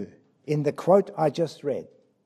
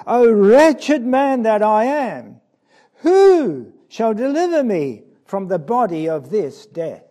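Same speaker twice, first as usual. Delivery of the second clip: anguished cry; 4 semitones higher, 8 dB louder than usual. Acted. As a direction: cry it out like a man in despair. Preacher voicing Paul's anguished cry.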